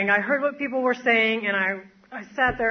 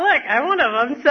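ordinary talk, nothing marked

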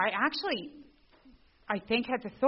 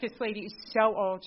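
mock scorn; 3 semitones higher, 7 dB louder than usual. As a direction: roll your eyes at her age. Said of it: "I'm like".

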